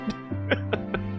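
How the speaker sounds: quiet, breathy chuckle; amused laughter. Real, not acted. Streamer chuckles at their own impression, amused. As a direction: laugh softly at your own bit.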